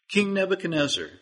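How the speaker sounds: high, quick call from heaven. Acted. God's voice from heaven calling the king by name, commanding. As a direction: call the king's name from above.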